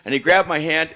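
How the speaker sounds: ordinary talk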